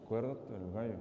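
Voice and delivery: soft low whisper, barely voiced. Shown as a demonstration. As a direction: hiss it secretly at him. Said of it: "se lo susurró".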